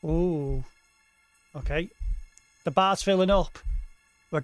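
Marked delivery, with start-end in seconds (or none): none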